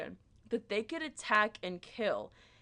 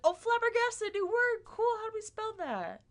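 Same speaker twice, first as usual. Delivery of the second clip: very high, loud pretend student; mock excited student; 12 semitones higher, 4 dB louder than usual. Student thrilled by a new word, high mock excitement. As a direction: squeal it with hammed-up wonder. Imagined student reacting with delight.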